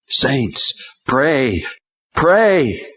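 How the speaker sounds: a desperate angel begging the saints; urgent plea. A narrator's reading. Angel urges the praying saints, rising to a cry.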